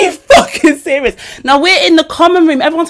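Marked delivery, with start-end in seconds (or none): 0.0-1.2 disbelief. Retold or imitated. Mike disbelieving, laughed lead-in.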